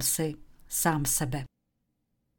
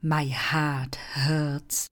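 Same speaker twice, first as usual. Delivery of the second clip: very slow, clear dictation; slow model sentence for students; slow example; much slower than usual. Shown as a demonstration. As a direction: spell it out word by word. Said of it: "znamenala věta".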